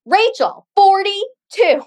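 mock-exasperated, each word set apart; exasperated reminder. Yoga staff reminding forgetful Rachel her spot, exasperated imitation.

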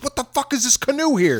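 high-pitched annoyed outburst; pissed off. Retold or imitated. Camp owner finding a stray canoe, mock pissed-off exclamation. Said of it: "he's all pissed off".

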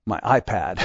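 remembering the lost iPad with a sinking gasp; hushed mock dismay; sudden dismay.

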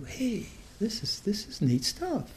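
a quiet, pleased realisation, voiced high and slow; delighted discovery. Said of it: "and you say".

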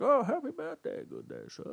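put-on high, soft old-man voice; old clerk. Correcting the impression of the liquor clerk, frail old man. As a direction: play a frail old gentleman. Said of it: "it's more like".

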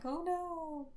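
timid creature. Retold or imitated. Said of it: "being like".

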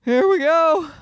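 high, slow mock-weary grandparent voice; imitating grandparents bracing for gifts. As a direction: sigh it out like tired grandparents buried in presents. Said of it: "They're just like".

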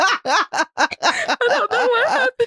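high, breathless laughing fit; laughing hard. Real, not acted. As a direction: crack up, can barely speak. Laughing fit telling story.

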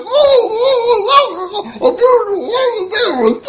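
high, wailing mock sobs; hammed-up sobbing. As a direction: wail it out like a big baby. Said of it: "He's like".

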